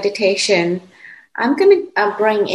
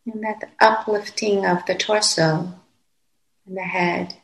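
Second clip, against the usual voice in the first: calm, unhurried meditation voice with long pauses; guided meditation. Real, not acted. Teacher guiding meditators to settle into the body, calm and unhurried. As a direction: guide them gently, phrase by phrase, leaving long silences.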